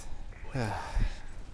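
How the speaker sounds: long defeated sigh; weary sigh. Real, not acted. Vlogger sighing before a gloomy confession to fans. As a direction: breathe out like you've given up. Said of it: "I don't really know what to say anymore".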